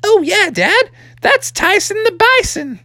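very high little-frog voice; high-pitched storybook kid; young frog son. Acted. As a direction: answer Dad as an eager little frog. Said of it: "And Froggy's like".

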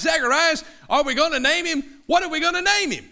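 calling to Zacharias. Retold or imitated. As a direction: call out to the mute father, pressing him.

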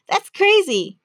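high-pitched, astonished exclamation; amazed reaction. Real, not acted. Host hearing of guest's first move abroad, amazed. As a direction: react in disbelief, voice jumping up.

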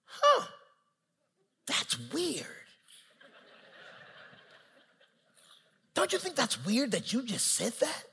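high, swooping mock puzzlement; Holy Spirit voice. Holy Spirit needling Nick, mock puzzled. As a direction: feign innocent wonder with exaggerated pitch swings. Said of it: "The Holy Spirit gonna start talking".

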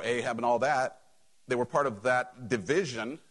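ordinary delivery

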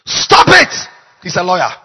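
booming heavenly veto; loud command. Retold or imitated. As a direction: shout it down from above. Heavenly voice forbids the oil.

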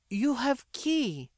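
put-on high, slow girl's voice coaxing; encouraging. A narrator's reading. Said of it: "she encouraged".

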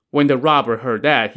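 ordinary delivery

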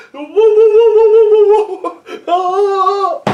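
high, loud, laughing woo woo fading into a yelp; cartoon coyote falling off a cliff; cartoon fall cry. Mocking the fall like the cartoon coyote, high laughing woos. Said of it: "Like the fucking coyote".

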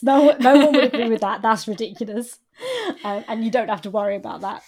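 loud, insistent reassurance; mock indignant reassurance; emphatic protest. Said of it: "he's the one that says".

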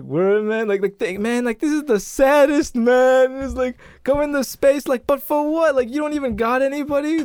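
high-pitched, incredulous heckling; mock incredulity. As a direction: imitate a kid pitying and needling someone. Kids mock lonely astronaut in sketch.

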